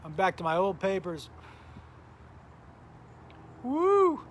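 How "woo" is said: high, loud happy whoop; excited whoop. Real, not acted. Streamer cheering to his chat, loud whoop. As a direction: let out a big cheer.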